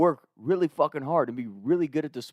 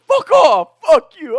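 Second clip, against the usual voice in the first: high, loud defiant yell; yelling back; 13 semitones higher, 11 dB louder, slower than usual. Retold or imitated. Trey yelling back at the coach, defiant yell. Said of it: "yelling back at our coach".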